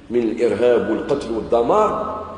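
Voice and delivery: usual speaking voice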